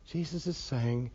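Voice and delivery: usual speaking voice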